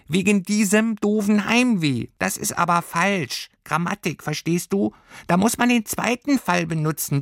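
high-pitched pedantic little lecturer; squeaky know-it-all creature. A narrator's reading. Creature teaching girl the genitive, squeaky smart aleck.

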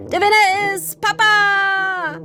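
high, loud victory shout; triumphant cry. A narrator's reading. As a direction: crow your own win. Papa triumphs, arms raised.

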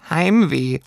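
hoher Einwurf des Tierchens; dazwischenredendes Pelztierchen. A narrator's reading. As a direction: fall hoch ins Wort. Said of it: "unterbrach sie das Heimweh". (hohes, vorwitziges Stimmchen)

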